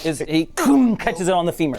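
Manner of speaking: ordinary talk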